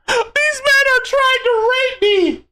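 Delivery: high, slow mock victim voice; shrill mocking quote; mocking impression. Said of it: "sound like a Drazia right now".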